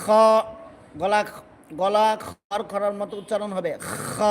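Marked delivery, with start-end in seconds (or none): none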